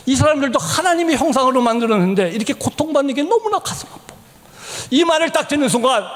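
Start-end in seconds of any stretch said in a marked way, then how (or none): 0.1-4.1 higher voice fading to a whisper; plead, then let the voice die away; imitated pained appeal; retold heartache. Wife's heartache, voiced in story.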